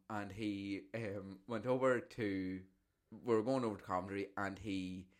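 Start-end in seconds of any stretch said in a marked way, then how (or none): none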